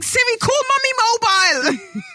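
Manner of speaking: high, exasperated yell at a voice assistant; high-pitched pleading command; exasperated shout. Retold or imitated. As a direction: shout the command in comic desperation. Re-enacts failing to command Siri.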